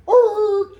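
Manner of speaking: high yelping bark; imitated dog bark. A hunting dog baying while chasing the bear. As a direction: bark like a hound on a trail. Dogs barking on the bear chase.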